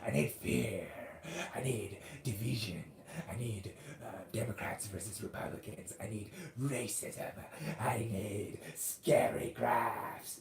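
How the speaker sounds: a hint of a creepy villain drawing out each need, the last line very slow; Scarecrow villain voice. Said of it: "The Scarecrow, right? It's like".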